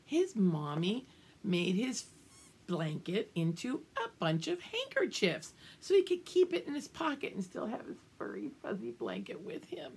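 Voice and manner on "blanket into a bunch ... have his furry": plain reading voice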